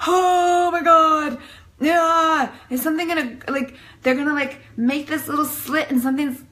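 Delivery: 1 freaking out. Retold or imitated. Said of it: "I was freaking out".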